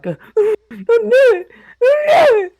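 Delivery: put-on scared kid whimpering don't do it; panicked pleading. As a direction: beg her to stop, near tears. Panicking as sister summons Jeff.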